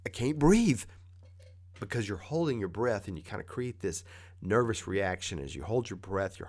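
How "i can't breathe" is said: high, loud, strangled gasp for air; breathless panic. Acted. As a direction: act out panicking with no breath to spare. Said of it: "feel like you can't breathe".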